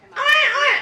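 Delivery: very high, loud imitation of a baby wailing; mock baby cry. Preacher imitating a crying baby to the congregation, high mock wail. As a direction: squeal it like a fussing infant.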